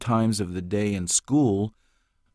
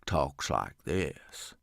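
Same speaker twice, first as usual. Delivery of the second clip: much lower, slow voice pushed through the jowls; heavy slow hillbilly Crick; 7 semitones lower, 8 dB quieter, much slower than usual. Shown as a demonstration. Voice actor demos the heavy hillbilly, low and slow through jowls. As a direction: drag it out like a four-hundred-pound cousin. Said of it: "is slow and speaks more through his jowls".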